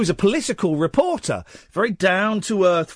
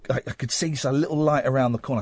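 normal talking voice

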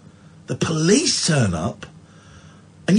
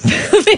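high laugh. Real, not acted. Laughs at friend's remark.

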